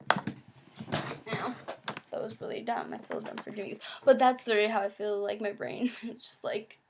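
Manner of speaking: ordinary delivery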